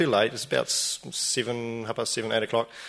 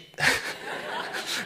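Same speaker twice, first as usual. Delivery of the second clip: laugh; 3 dB quieter than usual. Real, not acted.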